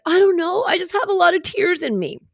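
high-pitched imitation of an upset child; tearful little boy. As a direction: answer like an overwhelmed little boy. Crying little boy's answer, acted out.

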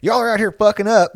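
loud, high-pitched imitated scolding; drunk dad. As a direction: tell the kids off as a worked-up drunk dad. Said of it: "fucking drunk".

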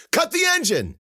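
high, loud frantic shout; urgent yell. A narrator's reading. Cece running to Aiko, urgent yell. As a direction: shout the order in panic. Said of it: "she yelled".

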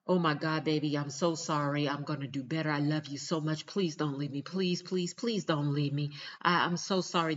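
loud, frantic begging of a caught cheater; mock tearful pleading. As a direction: imitate a partner desperately begging forgiveness. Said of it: "They cry, they hug on to you".

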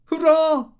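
high, loud cheer of joy; hurrah, bursting with delight. A narrator's reading. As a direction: throw it up like a happy shout. Diarist cheering arrival.

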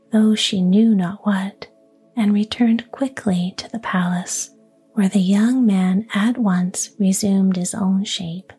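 plain reading voice

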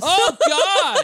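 high-pitched laughing exclamation. Real, not acted. Laughing reaction to old post.

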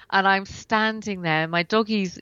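ordinary delivery

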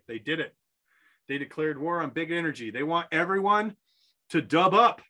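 earnest mock-commercial pitch; tongue-in-cheek sponsor read. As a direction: sell it like a late-night ad.

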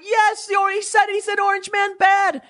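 high, shouted fan frenzy; mock screaming fan. Audience members screaming after the host's line, high mock shout. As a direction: shriek it like a giddy fan. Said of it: "They start screaming and clapping like".

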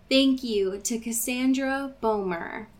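ordinary delivery

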